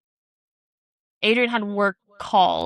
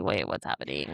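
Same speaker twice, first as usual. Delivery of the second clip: put-on confused kid; 8 dB quieter than usual. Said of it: "now he's like".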